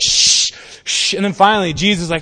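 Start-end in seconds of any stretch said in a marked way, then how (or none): 0.0-1.1 sharp crowd shushing; acted "shh" to silence him; loud hushing shh. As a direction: hush someone with a long shh. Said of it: "people are like".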